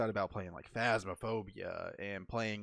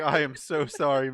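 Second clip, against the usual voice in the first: loud, slow, raised-pitch apology; emphatic apology; 4 semitones higher, 10 dB louder than usual. Retold or imitated. Apologizing to Mags over the wrong room, loud and emphatic. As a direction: draw out the retold apology loudly.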